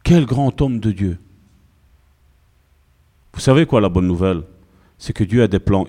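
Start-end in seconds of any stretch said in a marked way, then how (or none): 0.0-1.2 mock awe. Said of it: "la personne qui est en face de toi".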